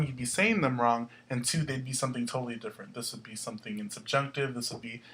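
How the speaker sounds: normal talking voice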